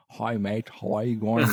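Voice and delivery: mock-Aussie greeting with rounded vowels; roundish Australian accent. Host showing how locals greet, roundish Australian accent. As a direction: imitate Australians who blur their letters.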